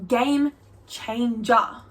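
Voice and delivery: very slow, drawn-out amazement; awed verdict. Retold or imitated. Speaker discovers period undies, awed and drawn out. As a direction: stretch each word out like a revelation.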